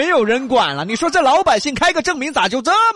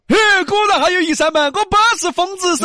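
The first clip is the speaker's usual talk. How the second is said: excited; 7 semitones higher, faster than usual. Acted. Boss jumps out triumphant.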